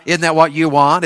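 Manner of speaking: normal talking voice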